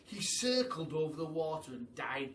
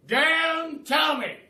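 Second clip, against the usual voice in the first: loud, slow, high shout; Duke crying out; 13 semitones higher, 16 dB louder, much slower than usual. Acted. The Duke interrupts the giraffe with a big slow shout. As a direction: bellow it out, drawn out. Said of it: "cried the Duke".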